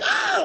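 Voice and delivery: high mock scream of pain; squealing mock shriek; retold scream. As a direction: yelp like the hot comb touched skin. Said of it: "I was like".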